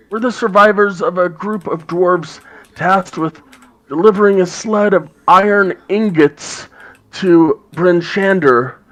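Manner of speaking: high, loud raspy dwarf woman; [name]'s raspy voice. Acted. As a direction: rasp it out as a gruff dwarf. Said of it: "in a raspy voice".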